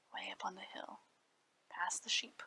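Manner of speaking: soft storyteller whisper; hushed. A narrator's reading. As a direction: let the words fall to a hush. Narrator drops to a hush.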